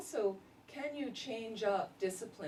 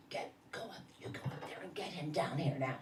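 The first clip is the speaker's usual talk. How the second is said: exorcist voice; 3 semitones lower, 4 dB quieter than usual. Retold or imitated. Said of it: "with this exorcist voice".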